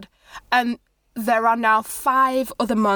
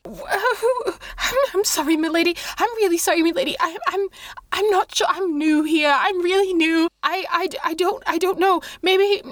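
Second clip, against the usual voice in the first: much higher, stammering pleading monk voice; terrified monk; 5 semitones higher, faster than usual. Acted. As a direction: beg for your life, tripping over words. Terrified monk begs not to die.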